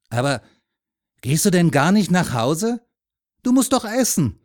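high, loud insistent urging; animated, pressing adult to child; concerned man. A narrator's reading. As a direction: question the boy with growing concern.

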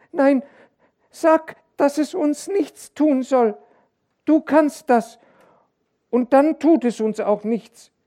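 sehr hohe, laute Kinderstimme; flehendes Kind. A narrator's reading. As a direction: den Vater wie ein ängstliches Kind anflehen. (kindlich flehend)

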